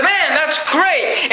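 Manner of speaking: high and very slow, savoring the win; excited Moses. Acted. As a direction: stretch it out in delight. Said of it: "Moses is excited".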